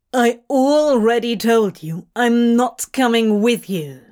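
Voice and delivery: sharp refusal. Acted. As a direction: cut him off with an edge. Crowley cutting him off.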